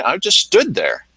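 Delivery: usual speaking voice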